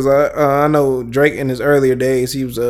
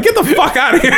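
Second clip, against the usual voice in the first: very high-pitched disbelief; mock outraged dismissal; 14 semitones higher, faster than usual. Speaker replays dismissing his friend's claim, mock outraged. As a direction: wave him off in high, playful outrage.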